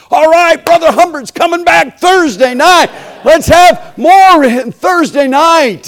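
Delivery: rally the crowd for Thursday; excited announcement. Retold or imitated. Host announcing the preacher's return, booming and excited.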